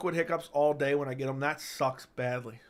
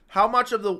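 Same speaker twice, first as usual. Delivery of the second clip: loud hiccup jolt; blurted around a hiccup; 7 semitones higher, 8 dB louder than usual. Real, not acted. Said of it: "I can't stop hiccup".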